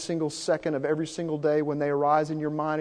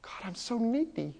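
quiet prayer; 5 semitones higher, 11 dB quieter than usual. Retold or imitated. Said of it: "what's been revealed".

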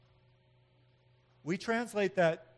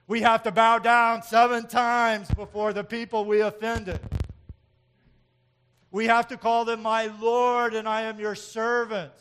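loud mock groveling; 6 semitones higher, 11 dB louder than usual. Mocking how we deal with others.

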